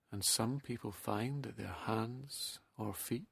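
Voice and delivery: soft, slow hypnotic meditation voice with long pauses; guided relaxation. Acted.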